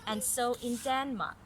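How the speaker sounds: clear, even read-aloud story voice; story narration. A narrator's reading. Mother reading a story to little Caris, storytelling voice. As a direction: read it like a picture book to a child.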